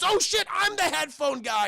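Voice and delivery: high, loud panicked realisation; sudden panic. Retold or imitated. Headphone guy hears his own warrant, panicked yell. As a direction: blurt it out in mock alarm.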